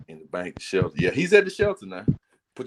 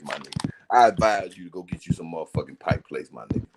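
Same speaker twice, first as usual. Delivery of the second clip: normal talking voice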